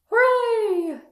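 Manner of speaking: high, loud monkey hooray; loud cheer. Acted. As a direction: shout it like a happy monkey.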